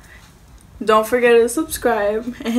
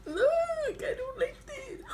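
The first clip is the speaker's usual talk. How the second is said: whiny cry; 12 dB quieter, slower than usual. Real, not acted. Crying out over spicy food.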